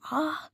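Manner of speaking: sharp high cry of pain. A narrator's reading. Viya cries out after being stabbed: high pained cry. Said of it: "ऐसा चिल्लाती है".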